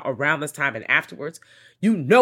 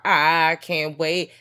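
slow, stretched, louder savoring; drawn-out glee; 3 semitones higher, 5 dB louder, much slower than usual. Pretend. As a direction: drag every word out with relish.

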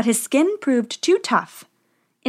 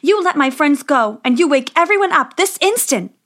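higher, louder angry demand; commanding princess; 5 semitones higher, 4 dB louder than usual. A narrator's reading. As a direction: order the witch to free them. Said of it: "she said".

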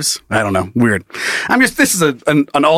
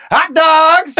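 stadium vendor hawking; 12 semitones higher than usual. Pretend. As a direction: call out wares to the crowd.

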